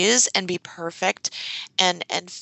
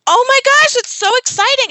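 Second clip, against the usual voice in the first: squealing, much higher and louder, all delight; Abby's excited thing; 8 dB louder, faster than usual. Retold or imitated. Hearing a student got a job, squealing with high loud excitement. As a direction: burst out with over-the-top excitement. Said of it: "I just did my Abby, I'm excited thing".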